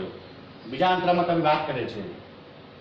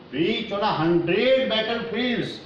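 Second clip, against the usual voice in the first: high, very slow verse reading; poem recitation; 4 semitones higher, much slower than usual. Real, not acted. Teacher reads verse aloud before translating, slow high recitation. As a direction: declaim the verse with drawn-out words.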